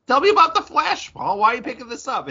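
jumps high and loud like an eager host; mock eager high voice. Co-host prompting Paul about the Flash in a high eager voice. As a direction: play the excited interviewer, way up high.

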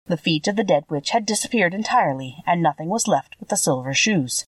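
ordinary delivery

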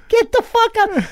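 very high-pitched incredulous exclamation; astonished disbelief. Retold or imitated. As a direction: act out being floored by the news. Acts out disbelief at kids' admission.

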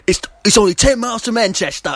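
quick, high mock Cockney line; bad movie Cockney. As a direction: rattle it off in a hammed-up fake Cockney accent. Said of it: "the peak of the terrible accents".

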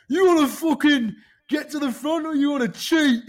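high, loud hammed-up yell; imitating Alistair's furious shout at the cheating pack; mock angry shout. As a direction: shout it like a furious rival on the bike. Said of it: "he starts going".

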